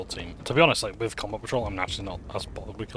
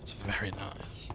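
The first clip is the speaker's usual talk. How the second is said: whisper; 8 dB quieter, much slower than usual. Real, not acted. Whispered comment on mansion.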